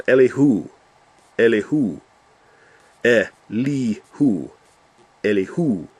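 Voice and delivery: "Elihu" as el-ee-HOO; repeated "Elihu", each time stressed on the end; last-syllable stress. Shown as a demonstration. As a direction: land hard on a long final "hu" in "Elihu". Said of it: "put the emphasis on the last syllable".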